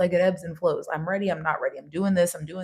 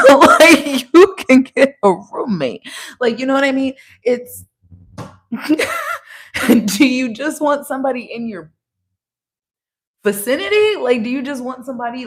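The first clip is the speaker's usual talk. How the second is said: cracking up, much louder and higher; laughing, louder and higher; 7 semitones higher, 8 dB louder than usual. Real, not acted. Laughing loudly at viewers who just want somebody around. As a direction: laugh it out loud while you ask.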